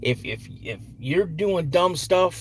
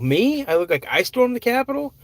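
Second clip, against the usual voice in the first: higher, surprised disbelief; incredulous; 9 semitones higher than usual. Real, not acted. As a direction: react in real disbelief. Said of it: "you look like you stormed the Capitol".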